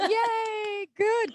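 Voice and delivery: high bright cheer; cheerful yay. Real, not acted. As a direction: cheer like good news. Host cheers at news.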